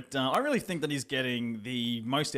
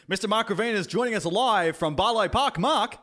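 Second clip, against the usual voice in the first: higher, louder radio intro; announcer intro; 9 semitones higher, 7 dB louder than usual. Acted. Host introducing the live cross, announcer style. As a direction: hype up the guest.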